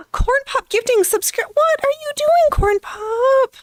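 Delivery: high, loud delighted gasping squeal; overjoyed at a surprise gift; excited squeal. Real, not acted. Viewer gifts subscriptions on stream.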